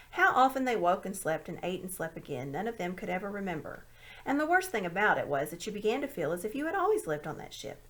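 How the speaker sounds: ordinary delivery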